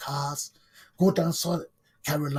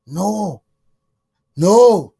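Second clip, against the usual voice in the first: furious shout; 3 semitones higher, 13 dB louder than usual. Real, not acted. Furious denial in political rant.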